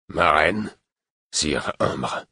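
stiff formal salutation. A narrator's reading. Buric greets his queen and Imbre, formal. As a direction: greet them strictly by protocol. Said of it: "fit-il d'un ton formaliste".

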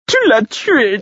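high, loud accusing shriek; a grief-stricken jester shrieking at the killer; shrieking fool. Acted. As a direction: scream the accusation at him. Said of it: "me cria le fou".

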